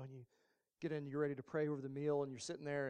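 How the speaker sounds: normal talking voice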